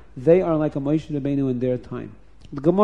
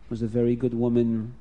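ordinary delivery, nothing marked

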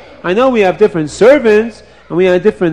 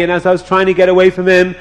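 ordinary delivery

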